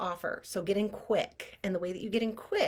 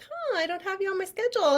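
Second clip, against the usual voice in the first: high, fast mock receptionist; imitating receptionist; 12 semitones higher, 5 dB louder, faster than usual. Receptionist telling her the appointment is lost, high and quick. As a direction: rattle it off in a high put-on voice. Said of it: "she was like".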